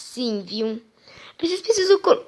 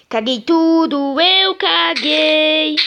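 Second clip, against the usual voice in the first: exasperated wail; 4 semitones higher, 5 dB louder, much slower than usual. Real, not acted. Messed up the build.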